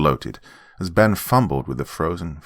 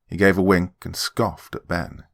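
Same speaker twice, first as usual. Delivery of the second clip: usual speaking voice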